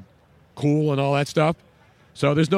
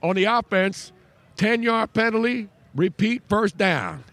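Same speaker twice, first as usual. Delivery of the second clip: higher, slow Southern referee announcing the penalty; Southern referee call; 7 semitones higher, slower than usual. Retold or imitated. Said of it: "Let me finish my call".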